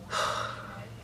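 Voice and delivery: long breathy exhale; exasperated sigh. Real, not acted. Woman recounting all this weird shit, exasperated sigh. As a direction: let out a weary sigh. Exasperated after the creepy man story.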